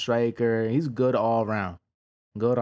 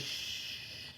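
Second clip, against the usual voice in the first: long unvoiced shh, barely audible; shushing the crowd; 17 dB quieter than usual. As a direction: hush the crowd with a soft shh. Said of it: "he was like".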